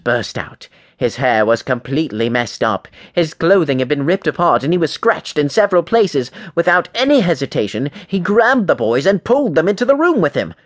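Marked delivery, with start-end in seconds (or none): none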